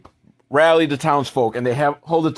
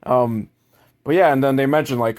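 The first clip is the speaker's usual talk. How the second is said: ordinary talk, nothing marked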